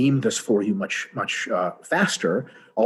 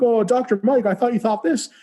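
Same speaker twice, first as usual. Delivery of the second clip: much higher, whiny gotcha tone; mocking critic voice; 10 semitones higher, 4 dB louder than usual. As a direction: mimic a smug commenter catching you out. Mimicking critics' gotcha comment.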